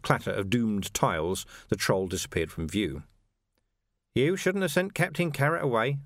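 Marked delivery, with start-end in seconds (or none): none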